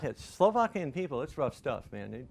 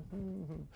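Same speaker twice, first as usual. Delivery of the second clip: soft, high little hum; quiet mock humming; imitated humming; 6 semitones higher, 12 dB quieter than usual. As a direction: hum absently to yourself, imitating dad. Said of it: "He's kinda humming".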